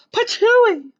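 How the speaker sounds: very high, loud spitting cry; high girlish spit; Goldilocks spitting. A narrator's reading. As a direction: spit it out with a shriek. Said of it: "cried Goldilocks".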